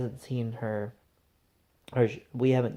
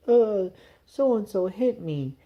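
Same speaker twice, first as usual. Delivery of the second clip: put-on higher, slow little girl's complaint; imitating a crying little girl; 8 semitones higher, 4 dB louder, slower than usual. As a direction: play the hurt child, slowly. Crying daughter voiced in story.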